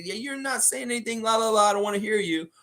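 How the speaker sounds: high singsong "la la la" blocking someone out; mock not listening. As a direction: drown them out in a high, sustained, childish taunt.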